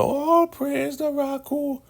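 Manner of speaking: very high, slow drawn-out praise cry; squealing elder praise. Retold or imitated. Elder greeting the highest with slow squealing praise. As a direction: stretch it into a squeal.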